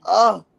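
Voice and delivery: a loud, disgusted ugh; disgusted groan. Real, not acted. Recalling the pain of sex, a disgusted groan.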